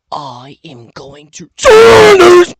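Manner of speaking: drawn-out, high, loud yell; excited yell. Real, not acted. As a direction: belt it out with excitement. Announces big move, yelling.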